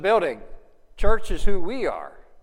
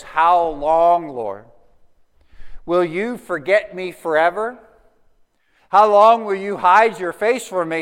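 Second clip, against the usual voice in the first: reading the psalm aloud, louder and pleading; scripture reading; 5 dB louder than usual. Acted. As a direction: plead the lament to God, loud and earnest.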